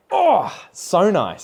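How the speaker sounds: high, loud, drawn-out swoon; swooning delight. Retold or imitated. Vlogger reacting to hearing South African accents, swooning. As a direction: gush slowly with exaggerated delight. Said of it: "I'm just like".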